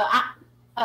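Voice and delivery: ordinary talk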